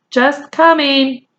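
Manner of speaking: calling back. Acted. Omri calls back.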